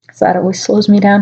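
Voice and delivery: ordinary delivery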